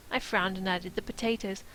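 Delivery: plain reading voice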